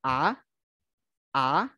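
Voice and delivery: "A" sliding up into a rise; second tone. Shown as a demonstration. Teacher shows the rising second tone. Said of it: "nadanya itu naik".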